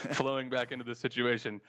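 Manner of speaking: ordinary talk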